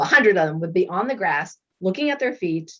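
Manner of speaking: ordinary talk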